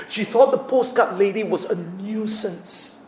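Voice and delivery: normal talking voice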